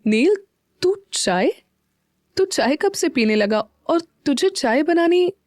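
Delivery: slow, high, astonished questions; surprised. A narrator's reading. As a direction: pitch climbing high in disbelief. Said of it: "Janvi हैरानी से बोली".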